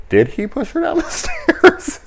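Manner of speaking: high question breaking into a laugh; laughing disbelief. Retold or imitated. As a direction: laugh through the shocked question. Laughing retold reaction.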